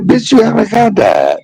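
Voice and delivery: high, indignant mom retort; scolding mother. Retold or imitated. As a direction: imitate mama putting her crying child straight. Acts mother scolding the crying son.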